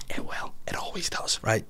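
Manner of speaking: breathy whisper to the audience; whispered aside. Pretend.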